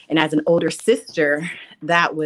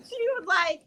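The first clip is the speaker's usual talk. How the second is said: usual speaking voice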